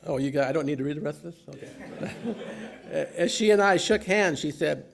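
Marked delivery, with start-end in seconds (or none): 0.0-2.4 laughing through the words; chuckling while asking, quickly; holy laughter. Real, not acted. Preacher laughs at his own joke. 2.4-3.1 soft breathy chuckle; quiet unvoiced laugh; holy laughter. Real, not acted. Laugh during the tie story. 3.2-4.4 laughing, higher and louder; words bursting with laughter; holy laughter. Real, not acted. Laughing through the punchline set-up.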